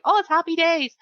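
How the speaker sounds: high sing-song fake glee; mock cheerful. Mocking the cheery game tone.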